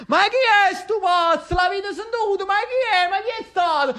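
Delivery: very high, laughing incredulous voice; laughing crowd. A narrator's reading. The people not believing their ears, high laughing mockery. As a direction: play a crowd jeering through laughter. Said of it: "e tutti ridevano".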